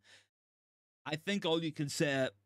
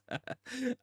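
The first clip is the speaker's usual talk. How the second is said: a short high burst of giddy laughter; stunned fan's high laugh; 9 semitones higher than usual. Real, not acted. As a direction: laugh out in disbelief, pitched up. Stunned fan laughing at result.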